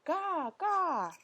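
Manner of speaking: put-on high playful baby squeal; gleeful baby babble. A narrator's reading. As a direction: babble it with delight. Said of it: "He began to play with it".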